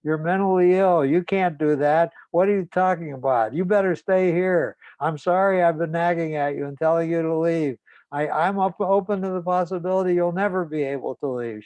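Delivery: imitated frantic mother, high and loud; retold upset yelling, pitched well up. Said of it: "she starts crying and yelling".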